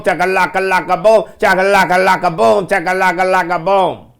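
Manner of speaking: repeated mocking beat sound; beatbox it sarcastically; mock beatbox chant.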